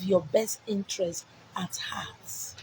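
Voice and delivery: hushed, slow confiding whisper; secretive whisper; whispered aside. Acted. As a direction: lean in and whisper it.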